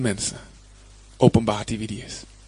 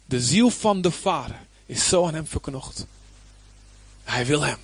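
ordinary talk, nothing marked

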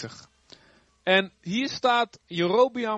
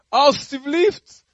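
much higher, louder, slow begging; pleading; 9 semitones higher, 7 dB louder, much slower than usual. Acted. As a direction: drag it out like a desperate plea. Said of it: "smeekte de koning". (smekende koning, hoog en traag)

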